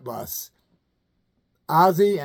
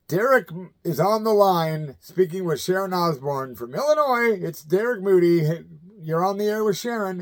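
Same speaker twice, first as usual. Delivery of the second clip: radio host voice; 2 semitones higher than usual. Retold or imitated. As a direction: announce the caller like a rock radio host. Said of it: "and they said".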